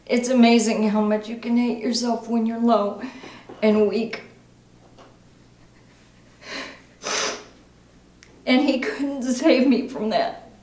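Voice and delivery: distraught, halting admission of guilt; anguished recovering alcoholic. Acted. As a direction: struggle through it in broken phrases. Acting exercise, confession continues after coach note.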